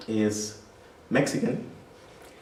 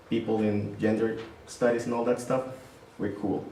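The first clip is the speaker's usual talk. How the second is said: ordinary talk, nothing marked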